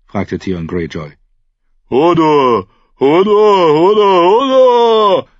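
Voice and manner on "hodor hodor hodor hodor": panicked, booming repeated cry; drawn-out, rising wail of a simple man; Hodor. A narrator's reading. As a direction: yell it high and loud, building each time. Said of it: "rief Hodor mit weit aufgerissenen Augen".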